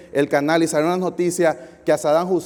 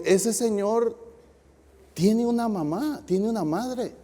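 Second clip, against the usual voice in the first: sad pity; 3 semitones higher, 7 dB quieter, slower than usual. Retold or imitated. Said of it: "mi esposa se puso bien triste".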